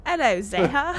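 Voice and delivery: loud Cockney "Hello, Zeha"; Cockney accent. Shown as a demonstration. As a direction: drop the h, Cockney style. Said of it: "Pretend that the future of these words are in Cockney".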